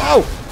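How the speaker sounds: high, loud oh; startled yell. Real, not acted. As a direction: cry out as the hit lands. Hit mid-sentence in the game.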